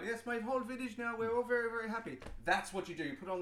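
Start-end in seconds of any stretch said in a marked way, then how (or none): 0.0-2.2 higher put-on caller voice. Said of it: "a paid actor".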